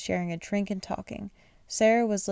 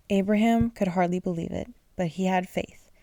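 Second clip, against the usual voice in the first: ordinary delivery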